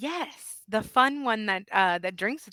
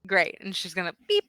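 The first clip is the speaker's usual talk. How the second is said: ordinary talk, nothing marked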